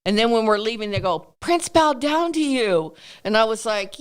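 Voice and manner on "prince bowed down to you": higher, drawn-out awestruck exclamation; imitated amazement. Young producers marvelling as they leave, imitated high amazement. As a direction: mimic someone amazed, high and slow.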